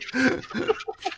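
high amused laugh. Real, not acted. Teller to the host, laughing at his diary line.

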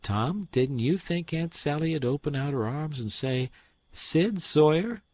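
spring the surprise; teasing reveal. Acted. Sid reveals himself.

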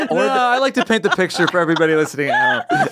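talking through laughter; high, giggly explanation; laughing. Real, not acted. Laughing while explaining joke.

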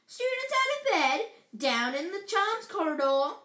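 high, loud tattling holler; bellow. Acted. Poltergeist reporting students in the corridor, bellowed. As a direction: call it out to the whole castle. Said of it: "Peeves bellowed".

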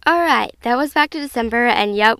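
usual speaking voice

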